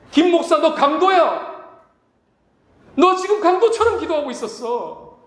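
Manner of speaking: Jesus shouting. Retold or imitated. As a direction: call him out like a thief. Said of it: "이렇게 지금 소리치는 거잖아요".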